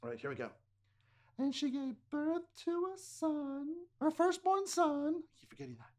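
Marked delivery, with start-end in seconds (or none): 1.4-5.9 princess voice. Acted. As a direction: sing it out like a fairy-tale princess. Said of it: "let's all be princesses".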